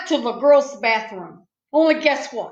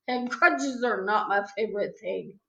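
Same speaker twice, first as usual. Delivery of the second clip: ordinary delivery, nothing marked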